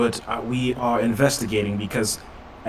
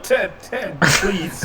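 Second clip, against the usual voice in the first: pleading; 9 semitones higher, much slower than usual. Acted. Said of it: "I'm like".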